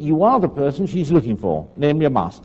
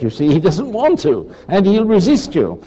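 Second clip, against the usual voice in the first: usual speaking voice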